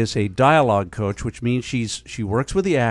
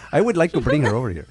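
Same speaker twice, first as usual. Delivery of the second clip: mock Irish accent; faster than usual. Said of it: "That's Irish".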